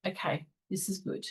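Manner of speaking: hushed, soft reassurance to herself; almost under her breath; quiet self-talk. Retold or imitated. Telling herself a bad moment is good, hushed.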